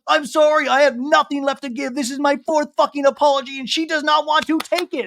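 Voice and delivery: high, strained whiny apology; mock whiny outburst. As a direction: plainte aiguë et exaspérée. Taylor's teary outburst quoted.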